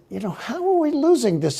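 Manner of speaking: high-pitched, puzzled self-quote; retold bafflement. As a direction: replay your own disbelief. Retells own baffled reaction.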